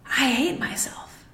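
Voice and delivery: whispered, slow, stress on hate. Acted. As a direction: breathe it out as a whispered confession. Repeats the thought, then whispers it.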